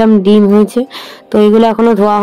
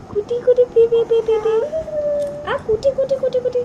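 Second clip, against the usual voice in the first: much higher playful cooing syllables; baby cooing; 13 semitones higher, 7 dB quieter than usual. Acted. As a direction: tickle the baby with sound.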